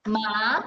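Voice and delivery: teacher models the rising tone; second tone ma. Shown as a demonstration. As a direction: lift Ma upward as the head nods. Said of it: "So then your voice will change".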